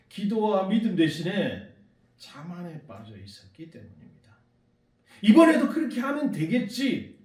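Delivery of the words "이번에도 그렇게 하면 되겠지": overconfident. Retold or imitated. Voicing the disciples' overconfidence.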